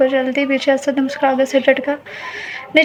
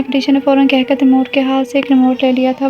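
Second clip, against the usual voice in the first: ordinary delivery, nothing marked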